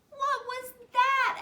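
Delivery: high, loud, slow frightened cry; scared question. Acted. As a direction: gasp it out as if spooked in the dark. Scary noise behind them.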